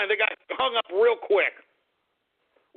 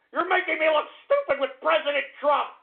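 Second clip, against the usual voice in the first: high, wounded complaint; hammed-up hurt outrage; indignant rant; 7 semitones higher than usual. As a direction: whine it at the callers. Ranting at prank callers.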